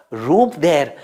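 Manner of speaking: ordinary delivery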